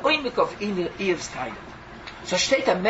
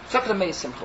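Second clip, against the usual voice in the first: normal talking voice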